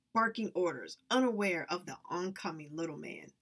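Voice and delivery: plain reading voice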